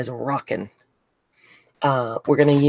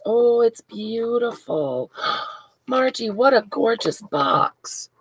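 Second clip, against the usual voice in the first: awed delight; 8 semitones higher, slower than usual. Real, not acted. Opening a gift box live.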